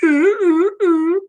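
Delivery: high little yips like a small dog; playful toy dog yip; tiny dog yip imitation. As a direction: mimic a tiny dog's weak yips. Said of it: "that little dog probably goes".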